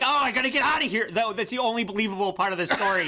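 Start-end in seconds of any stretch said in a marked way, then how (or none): 0.0-1.1 higher-pitched, rushed mock escape line; mock urgency. Co-host imitating Opie eager to flee the hospital, mock urgency. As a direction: blurt it fast, imitating someone bolting. Acting out Opie fleeing the story.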